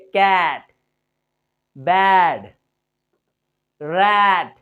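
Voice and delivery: "कैट, बैड, रैट" loud, with wide-open, stretched ऐ; accented ऐ vowel. Shown as a demonstration. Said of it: "मेरे मुंह को देखिएगा".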